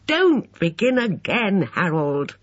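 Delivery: high, slow pleading; drawn-out high cry of protest; distressed cry. A narrator's reading. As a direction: plead wearily with a brother, voice lifted. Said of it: "cried Emma".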